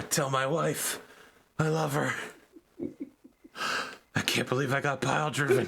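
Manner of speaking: a hint of a dying soldier. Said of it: "your friends going".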